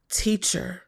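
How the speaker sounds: whispered word. Real, not acted. Listing Leo's roles softly.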